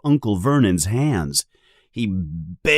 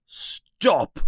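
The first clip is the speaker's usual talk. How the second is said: a restrained yell, pitched up; mild yell; 9 semitones higher than usual. A narrator's reading. Uncle interrupts the giant, mildly yelling. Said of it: "yells Uncle Vernon".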